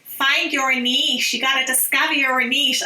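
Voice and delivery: quick, half-successful American marketer impression; attempted American accent. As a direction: imitate an American marketer banging on. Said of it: "did an American accent there or tried to".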